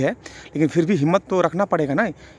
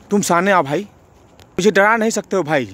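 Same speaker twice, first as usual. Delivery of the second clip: ordinary talk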